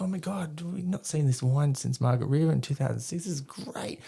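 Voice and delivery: high-pitched mock drunken delight; imitating tipsy, nostalgic enthusiasm; gushing excitement. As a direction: gush over a rediscovered favourite. Reminiscing drunkenly over wine with wife.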